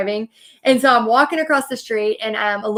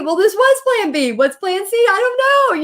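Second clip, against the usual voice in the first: high, loud, rising panic; panicked; 9 semitones higher, 4 dB louder than usual. Retold or imitated. At the bar asking about plan C, in a high loud panic. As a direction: spiral into alarm.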